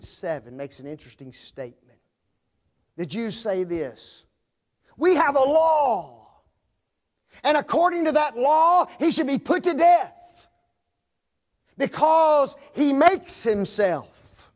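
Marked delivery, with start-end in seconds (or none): 5.0-14.5 accusing shout. Acted. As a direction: hurl the law at Pilate.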